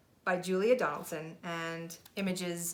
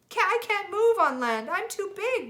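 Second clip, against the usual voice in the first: moaning whale; 13 semitones higher, 9 dB louder than usual. Acted. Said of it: "moaned the whale".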